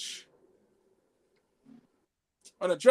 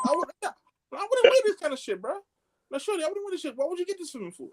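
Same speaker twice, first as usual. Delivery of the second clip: usual speaking voice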